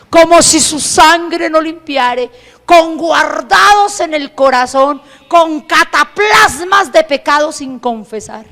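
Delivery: loud, slow, weighty sermon build; fiery preaching. Acted. Preacher warning the congregation about hidden sin, loud and slow. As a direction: hammer each phrase slowly to the pews.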